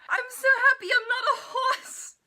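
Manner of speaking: high, loud, laughing squeal; comic giddy squeal, laughing through it; squealing delight. Retold or imitated. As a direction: shriek it with giddy joy. Quoting odd funny line.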